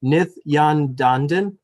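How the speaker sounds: Nithyandandan drawn out slowly, syllable by syllable, rising into a question; tentative mispronunciation. Real, not acted. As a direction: slowly attempt Nithyandandan with "dan-dan" in place of "nan-dan", unsure. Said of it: "I'm going to mispronounce".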